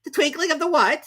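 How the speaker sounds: high, loud baffled question; the king can't follow; puzzled king. A narrator's reading. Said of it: "said the king".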